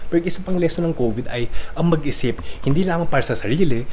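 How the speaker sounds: loud, high-pitched mock lecture in Filipino; hammed-up exasperated pleading, voice pushed up and loud; parody rant. Vlogger parodying a video, scolding gym-goers about covid, loud mock rant. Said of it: "to post a parody video".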